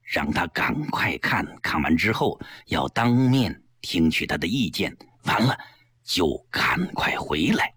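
low, careful urging; instructing. Acted. Boss briefs secretary on the errand, careful instruction. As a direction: spell out the errand in a deep voice. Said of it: "李济深嘱咐道".

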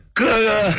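high, drawn-out wail, sobbing for his big brother; wailing cry. Acted. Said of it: "声泪俱下，嚎啕大哭". (声泪俱下地嚎啕大哭)

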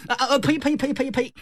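high, rapid "pei" spits; repeated mock spitting. Brother takes back his unlucky vow, high hurried spitting. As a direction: spit away a slip of the tongue.

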